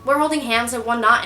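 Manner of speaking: ordinary talk, nothing marked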